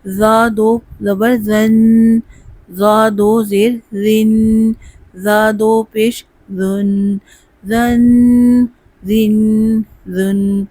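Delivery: slow, spelled-out drill for students to repeat; slow drill recitation. Shown as a demonstration. Teacher leading a spelling drill, slow. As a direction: chant each syllable slowly. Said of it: "ذا کو پر پڑھتے ہیں".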